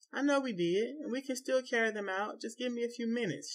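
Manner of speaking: high, light, coaxing voice; wife asking husband for time, high; pleading request. A narrator's reading. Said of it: "she requests".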